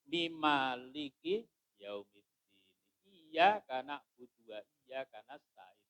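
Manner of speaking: soft, measured chant; Quran recitation. Shown as a demonstration. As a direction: recite evenly with lengthened vowels, fading at verse ends.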